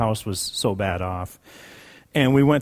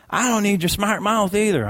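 sassy clerk; 9 semitones higher than usual. Retold or imitated. Clerk voiced in retold quarrel.

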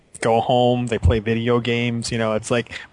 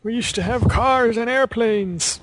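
old war veteran reminiscing, hammed up; 1940s announcer voice; 10 semitones higher than usual. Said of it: "announcer guy".

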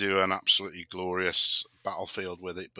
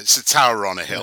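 louder; 9 dB louder than usual. Real, not acted. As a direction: say it again much louder. Repeats it louder for the others.

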